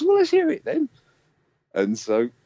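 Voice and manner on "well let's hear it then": very high, louder bright demand; high eager lady. Retold or imitated. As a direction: chirp it like an impatient executive. A&R woman demands playback.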